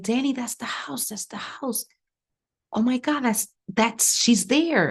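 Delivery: high-pitched, urgent mock scream; retold scream. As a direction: cry out your discovery, high and urgent. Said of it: "And I scream".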